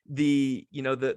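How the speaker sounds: usual speaking voice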